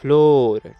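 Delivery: teacher modelling reading for beginners; careful word-by-word reading. Shown as a demonstration. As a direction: stretch each word and pause after it. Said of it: "अच्छे से पढ़ते हैं".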